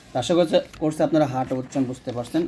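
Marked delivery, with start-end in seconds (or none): none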